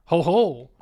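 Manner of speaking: loud gloating ho ho laugh; hammed-up villain chuckle.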